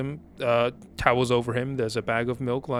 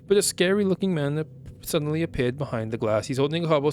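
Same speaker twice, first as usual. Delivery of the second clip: normal talking voice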